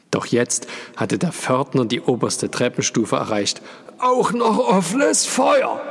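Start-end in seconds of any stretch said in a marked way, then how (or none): 4.0-5.8 very high, strained groaning shout. A narrator's reading. Porter reaching the top stair, seeing the flame, groaning loudly. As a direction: wheeze it out loud, horrified and slow. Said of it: "ächzte er".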